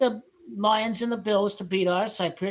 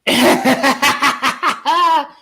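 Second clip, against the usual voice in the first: high loud laughing fit; loud cackle; 5 semitones higher, 11 dB louder than usual. Real, not acted. Streamer laughing at the Cowboys, loud cackle. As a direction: burst out cackling.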